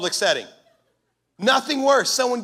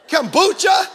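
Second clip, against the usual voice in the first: comic shout; 7 semitones higher, 7 dB louder, slower than usual. Real, not acted. Pastor mocking a lovestruck man's new habits, shouted. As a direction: shout the punchline word. Comic list of dating changes.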